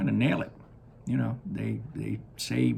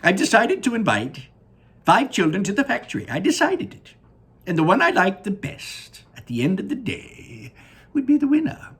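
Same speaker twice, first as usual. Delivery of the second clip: Willy Wonka voice; 11 semitones higher, 7 dB louder than usual. Acted. Wonka gazing down at his factory, high and delighted. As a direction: sing it out like a proud candy maker.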